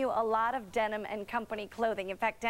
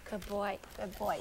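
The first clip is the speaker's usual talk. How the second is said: soft dog praise; 4 semitones lower, 5 dB quieter than usual. Real, not acted. Host praising her dog on set.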